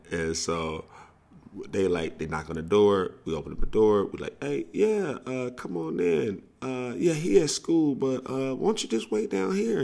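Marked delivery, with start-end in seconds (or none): 4.4-9.8 put-on much higher, eager boyish welcome; fake smooth host. Nervous boys welcoming girls at the door, high eager voice. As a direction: play it cool but squeak with nerves.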